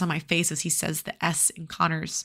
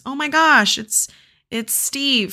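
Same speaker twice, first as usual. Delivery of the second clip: excited; 7 semitones higher, 3 dB louder, slower than usual. Real, not acted. Sudden realisation about Steve.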